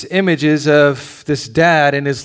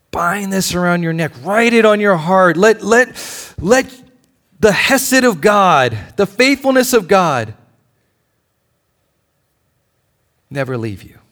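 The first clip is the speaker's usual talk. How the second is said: passionate plea; 5 semitones higher, 4 dB louder than usual. Retold or imitated. Passionate Solomon quoted.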